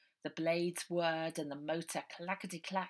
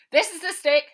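higher, louder over the engine; shouted over engine; 11 semitones higher, 14 dB louder than usual. Acted. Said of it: "shouted Harry over the noise".

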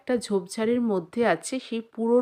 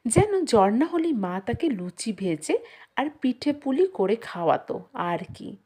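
plain reading voice

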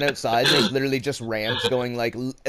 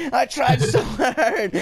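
slow, high, laughing wail; laughing despair; 9 semitones higher, 4 dB louder, much slower than usual. Real, not acted. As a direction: laugh through the complaint. Laughing despair after losing.

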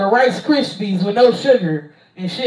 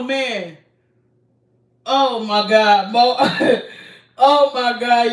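ordinary talk

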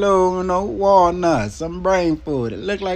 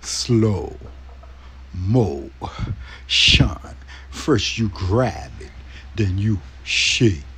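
deep, dragged-out slow-mo voice; slow motion; 9 semitones lower, slower than usual. Pretend. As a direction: talk like a slowed-down video, low and heavy. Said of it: "I'm gonna have to talk in slow motion too".

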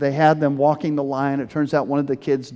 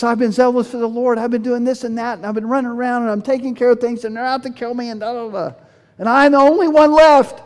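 Elijah; 8 semitones higher, 3 dB louder, faster than usual. Retold or imitated. Mimics Elijah's fearful, self-justifying complaint.

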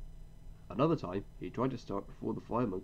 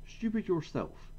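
high, slow cheeky comeback; cheeky retort; 9 semitones higher, slower than usual. A narrator's reading. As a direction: throw the insult back. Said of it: "said Duck".

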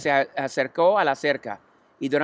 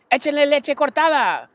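put-on very high, loud boyish voice; a kid suggesting mischief; cheeky boy; 11 semitones higher, 5 dB louder than usual. A narrator's reading. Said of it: "sugirió Avery".

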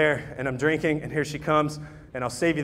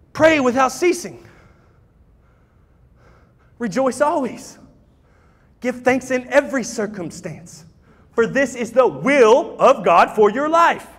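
scripture reading; 5 semitones higher, slower than usual. Acted. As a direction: let each verse land gently and slowly. Reads scripture over music.

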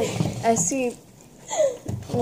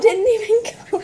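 laughing; 11 semitones higher, 3 dB louder, faster than usual. Real, not acted. Laughing at the mixed smoothie.

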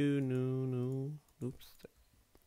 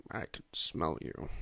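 slow, low breathy imitation; a light stab at a deep narrator; Morgan Freeman impression; 5 semitones lower, 7 dB quieter, slower than usual. Retelling a YouTuber's impression, slow low mock voice. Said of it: "And, uh, he did it".

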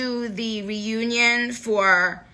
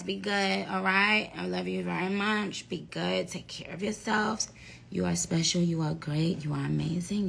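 soft, sincere goodbye getting softer; softer and softer, fading to a whisper; tender goodbye; 8 dB quieter than usual. Real, not acted. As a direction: reassure the fans gently.

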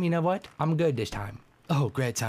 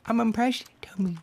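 lightly higher flirty voice; 7 semitones higher than usual. Acted. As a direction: flirt with Toby in a put-on voice. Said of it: "not if you sound like that".

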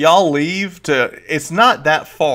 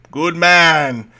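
louder, higher hearty praise; Volo approving; 3 semitones higher, 9 dB louder than usual. Acted. Volo praises the player for bringing wine, hearty. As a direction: clap him on the back with words. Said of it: "he goes".

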